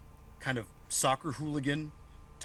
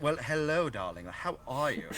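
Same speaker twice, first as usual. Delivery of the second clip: hammed-up posh British darling accent; mock posh English; 2 semitones higher than usual. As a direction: greet like a very posh English socialite. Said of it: "That does sound posh".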